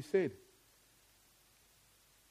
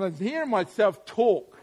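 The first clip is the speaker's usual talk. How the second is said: usual speaking voice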